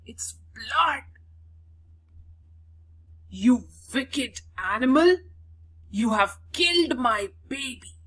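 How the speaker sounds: a lightly voiced screaming wife blaming the mongoose; mock hysterical scream. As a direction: play the furious wife lightly, accusing the pet. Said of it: "She screamed hysterically".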